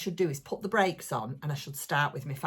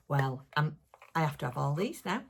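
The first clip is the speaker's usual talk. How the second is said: ordinary talk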